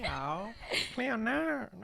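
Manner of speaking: high, soft stab at Australian vowels; badly imitated Australian from a TV show; attempted Australian accent. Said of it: "I can't do it anymore".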